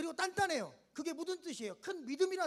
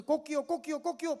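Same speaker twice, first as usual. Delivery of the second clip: mock rooster crowing, louder; a quick playful cock-a-doodle; 6 dB louder than usual. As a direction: crow it out like a rooster.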